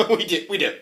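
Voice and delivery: high, laughing 'we do, we do'. Acted. Queen laughing as she admits it to the court. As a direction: giggle it out loud.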